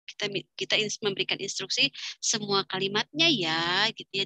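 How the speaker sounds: ordinary talk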